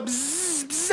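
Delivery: soft buzzing like a bee. Pretend. Reveler replaying being the queen bee, playful buzz. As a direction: imitate a little bee in flight.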